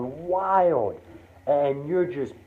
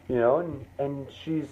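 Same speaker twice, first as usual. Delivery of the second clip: ordinary delivery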